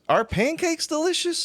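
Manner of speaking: mock disbelief. Real, not acted. Mocking disbelief.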